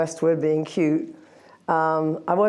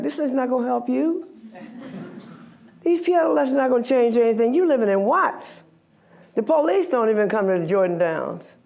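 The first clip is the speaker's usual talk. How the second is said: higher, with a laugh, quick and dismissive; inner disbelief; 6 semitones higher than usual. Retold or imitated. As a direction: scoff at the hopeless lessons, pitched up. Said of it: "I'm looking at them like".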